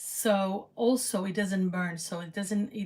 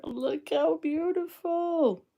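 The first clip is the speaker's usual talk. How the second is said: cooing; 10 semitones higher, 4 dB louder, slower than usual. Real, not acted. Cooing over the finished dish.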